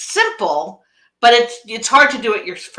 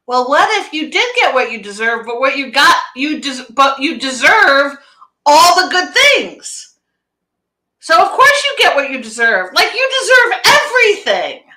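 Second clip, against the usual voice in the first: rising higher and louder, stretched out on all the good things; uplifting reframe; 5 semitones higher, 4 dB louder than usual. Retold or imitated. Coach reframing the saying for her client, uplifting. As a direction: lift her up with delighted conviction.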